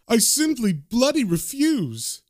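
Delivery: high, slow, haughty protest; indignant refusal. Acted. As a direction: draw out each word in acted outrage. Rich man indignantly refuses.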